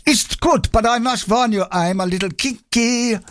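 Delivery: high hammed-up German accent. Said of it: "pantomime German accent".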